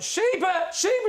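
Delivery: high-pitched pretend calling; dog-calling shout. Panelist fakes searching as the owner walks away, high calling shout. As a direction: call the missing dog, high and urgent.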